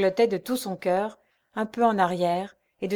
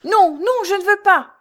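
much higher, loud terrified cry; panicked refusal; 11 semitones higher, 9 dB louder than usual. A narrator's reading. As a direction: recoil in panic. (refus paniqué, voix aiguë)

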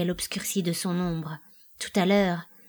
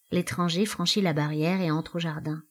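ordinary talk, nothing marked

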